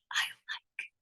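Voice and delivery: breathy intimate whisper; sultry whisper. Acted. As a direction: whisper it into a lover's ear. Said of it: "a lover's embrace, a whisper".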